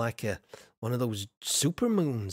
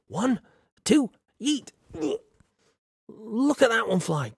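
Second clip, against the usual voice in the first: excited countdown; 8 semitones higher than usual. Real, not acted. Streamer throwing a biscuit to a viewer, excited. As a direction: count up and fling it.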